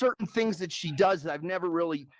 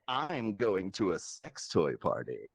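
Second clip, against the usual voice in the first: slow, very low, softer mock girlfriend voice; absurdly deep voice; 12 semitones lower, 5 dB quieter, slower than usual. Girlfriend telling her weekend plans, comically deep slow voice. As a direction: drop way down and drag the words out. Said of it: "Man, your voice is deep".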